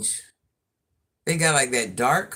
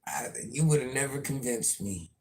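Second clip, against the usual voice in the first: ordinary talk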